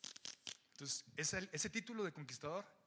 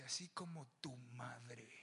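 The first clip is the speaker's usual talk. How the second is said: soft, slow, quieter sensei line; Miyagi-style verdict; 6 semitones lower, 6 dB quieter, slower than usual. Retold or imitated. Samuel as Miyagi tells Agag, slow and soft. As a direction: deliver it calm like the karate master. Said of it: "le dice".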